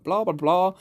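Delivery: high mocking blah blah; mocking singsong. Mocking troll.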